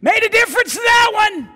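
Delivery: high, loud and proud; loud, ringing punchline from the boy; triumphant reply. Retold or imitated. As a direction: let the boy win the argument.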